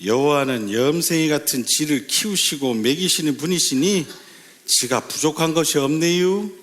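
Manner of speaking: Chungcheong-accented psalm reading; Chungcheong dialect. Shown as a demonstration. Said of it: "충청도 버전이 있더라고요".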